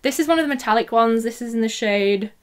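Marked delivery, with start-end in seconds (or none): 1.2-2.3 deep voice. Real, not acted. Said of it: "I went really deep then".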